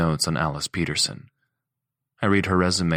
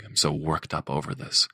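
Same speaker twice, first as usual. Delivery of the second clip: ordinary delivery, nothing marked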